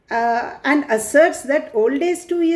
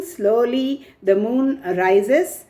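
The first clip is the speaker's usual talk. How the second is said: normal talking voice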